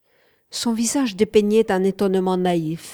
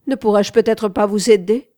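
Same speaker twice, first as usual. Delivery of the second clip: loud, teasing question; mocking offer; 3 semitones higher, 8 dB louder than usual. A narrator's reading. Wanda offers the confused painter help, mocking and loud. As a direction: make a raised, mock-helpful offer. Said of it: "d'un air moqueur".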